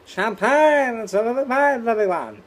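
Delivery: a camp, shrill Little Britain sketch voice; high, loud Little Britain character; Little Britain impression. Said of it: "if you know Little Britain".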